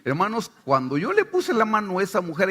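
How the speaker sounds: ordinary talk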